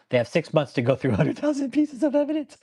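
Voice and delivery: high, laughing disbelief; high laughing mock outrage; laughing incredulously. Real, not acted. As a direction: laugh through it. Mocking, laughs mid-line.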